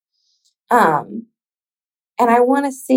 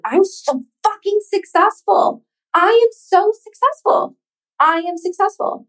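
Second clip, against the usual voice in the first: shouted affirmation; 10 semitones higher than usual. Shown as a demonstration. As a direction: belt it out like a victory cry. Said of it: "and I even shout it".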